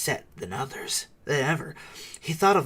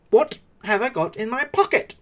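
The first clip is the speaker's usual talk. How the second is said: higher, louder repeat of the question; 11 semitones higher, 8 dB louder than usual. A narrator's reading. Bilbo repeating his riddle to Gollum, louder. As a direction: press the question harder. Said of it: "he said louder".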